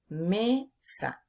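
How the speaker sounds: Mesa broken into me... sa; slow teaching split, me, then sa; syllable by syllable. Shown as a demonstration. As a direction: separate the two syllables of Mesa with a gap. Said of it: "Sa, última sílaba".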